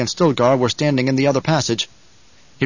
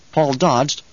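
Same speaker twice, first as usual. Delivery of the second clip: usual speaking voice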